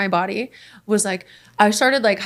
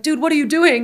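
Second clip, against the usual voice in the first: higher, mildly exasperated retold protest; pitched-up mock disbelief; alarmed protest; 7 semitones higher, 4 dB louder than usual. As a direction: imitate someone appalled at a friend. Passenger challenges aggressive driver, retold.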